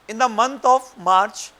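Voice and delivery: very slow, spaced-out dictation; dictating. Shown as a demonstration. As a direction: read each phrase slowly for notes. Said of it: "एक question लिख लो बच्चों".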